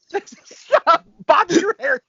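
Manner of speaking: high, loud teasing yell at the screen; half-laughing high-pitched shout at Bobby; mock shout. Real, not acted.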